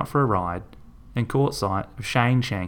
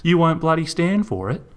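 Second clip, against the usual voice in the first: incredulous; 8 semitones higher than usual. Acted. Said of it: "she asked".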